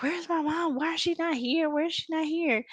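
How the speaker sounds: high, panicky retelling of the bride; mock freaking out. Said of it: "she was over there freaking out".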